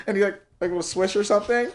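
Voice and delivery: ordinary talk, nothing marked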